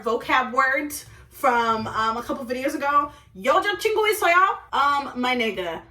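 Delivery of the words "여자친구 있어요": quick, high, pointed question. Retold or imitated. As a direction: fire off the Korean question in a raised pitch. Acted questioning of suspected cheater.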